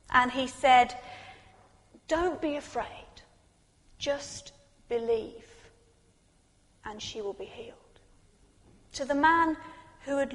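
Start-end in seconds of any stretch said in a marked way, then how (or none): 2.1-8.0 hushed, gentle reassurance; tender reassurance. Acted. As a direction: comfort a grieving father gently. Voicing Jesus comforting a grieving father.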